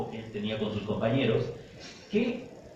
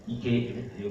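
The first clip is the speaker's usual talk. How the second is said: ordinary talk, nothing marked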